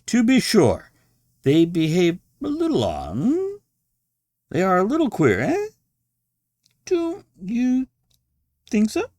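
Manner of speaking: high, loud, insinuating voice; sly knowing host. A narrator's reading. Host hinting that patients are odd, sly. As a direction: nudge the guest with a wink.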